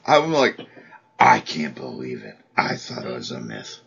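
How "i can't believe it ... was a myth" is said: low, raspy actor impression; Harvey Fierstein voice. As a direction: do a gravelly Fierstein.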